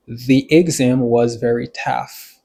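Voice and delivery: slow, deliberate example sentence; slow model. Shown as a demonstration. Teacher translating an example for learners, slow and deliberate. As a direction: spell it out slowly for learners.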